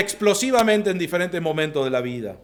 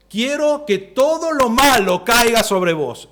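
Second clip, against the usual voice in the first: slow, louder, higher malediction; acted curse; 5 semitones higher, 5 dB louder, slower than usual. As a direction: pronounce a curse on them, emphatically. Acting out someone cursing another. (maldición actuada, lenta y fuerte)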